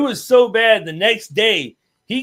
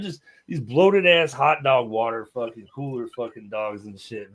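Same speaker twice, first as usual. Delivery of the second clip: ordinary talk, nothing marked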